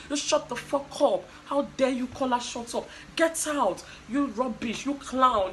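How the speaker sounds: loud, heated mock tirade; angry outburst. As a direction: act out jumping up to defend someone. Acting out housemate's angry outburst.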